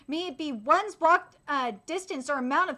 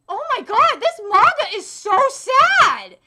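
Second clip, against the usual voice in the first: loud, high and slowed, a hammed-up wail; mock anguished wail; 7 semitones higher, 11 dB louder, slower than usual. Retelling reading the sad manga, a hammed-up loud wail. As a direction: drag out the despair like a wounded fan.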